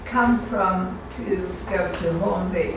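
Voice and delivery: normal talking voice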